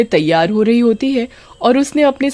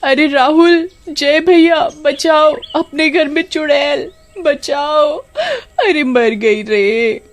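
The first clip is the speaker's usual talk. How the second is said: screaming for help; 8 semitones higher, slower than usual. Acted. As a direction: scream for the brothers in terror. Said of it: "जोर जोर से चिल्लाने लगती".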